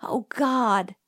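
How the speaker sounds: whispery, horrified exclamation; hushed, airy alarm; breathed exclamation. A narrator's reading. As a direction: breathe it out in dread. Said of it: "breathed Jim".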